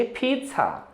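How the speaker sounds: पिज़्ज़ा quick and soft, pitsa with ts; correct pronunciation. Shown as a demonstration. Teacher quickly showing students the right पिज़्ज़ा. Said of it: "तेजी से बोल दीजिए".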